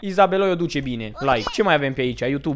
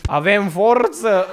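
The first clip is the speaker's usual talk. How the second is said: louder, slow, hammed-up "Avem forță!" with a long drawn "forță"; mock rally cry; 5 semitones higher, 7 dB louder, slower than usual. Repeating the clip's slogan to viewers as a slow, loud rally cry. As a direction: shout "Avem forță!" like a pumped-up vlogger. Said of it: "Omul meu a zis".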